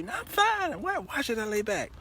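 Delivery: put-on high, indignant girlish voice; daughter's high voice. Daughter refuses to lie back, high and indignant. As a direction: push back like an annoyed teen.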